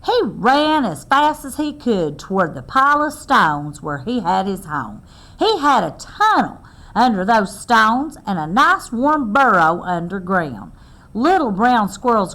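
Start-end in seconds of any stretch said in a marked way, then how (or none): none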